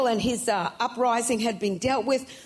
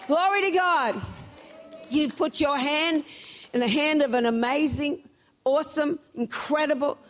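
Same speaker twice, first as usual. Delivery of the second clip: full-force preaching; 5 semitones higher than usual. Acted. Preacher to church about the certain woman, fervent preaching. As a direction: lift the congregation, press every line.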